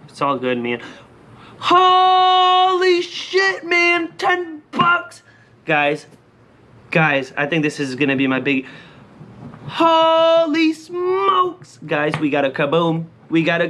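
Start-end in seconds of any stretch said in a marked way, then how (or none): 1.6-5.2 high, stretched-out amazed yell; long, high holler of delight; excited shout. Real, not acted. Reacting to winning ten bucks. 9.7-11.8 high, long-drawn amazed holler; real yell of astonishment; amazed shout. Real, not acted. Amazed by a scratch ticket win.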